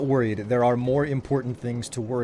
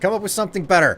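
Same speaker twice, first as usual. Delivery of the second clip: higher and louder, bossy playful order; mock demand; 8 semitones higher, 7 dB louder, faster than usual. Telling chat to find a better accent, hammy bossy order. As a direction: command chat like a fussy boss.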